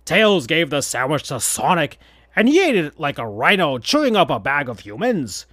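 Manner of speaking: ordinary delivery, nothing marked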